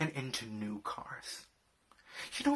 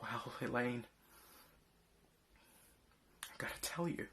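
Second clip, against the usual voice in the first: ordinary talk, nothing marked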